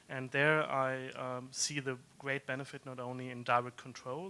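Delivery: normal talking voice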